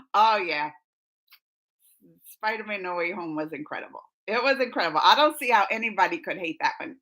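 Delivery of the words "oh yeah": ordinary talk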